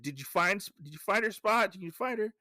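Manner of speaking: high, eager acted questioning; urgent questioning. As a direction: press a companion for news. Urgently asks dog during search.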